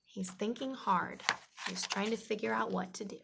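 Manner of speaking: ordinary delivery, nothing marked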